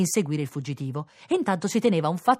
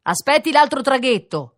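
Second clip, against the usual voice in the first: loud, raised-pitch shout; sailor calling out; 8 semitones higher, 6 dB louder than usual. A narrator's reading. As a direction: call across the water to him. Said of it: "gridò un marinaio a quel signore". (richiamo gridato da marinaio)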